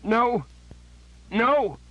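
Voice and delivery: desperate anguished shout; cry of anguish. A narrator's reading. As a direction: howl it out in agony. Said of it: "let out a cry of anguish".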